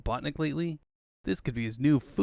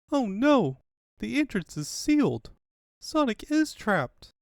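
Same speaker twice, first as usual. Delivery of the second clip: high, panicked exclamation; Sally realising Sonic is trapped, high and panicked; alarmed cry; 9 semitones higher, 3 dB louder, slower than usual. Acted. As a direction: gasp it out in fright. Sally sees Sonic sealed in.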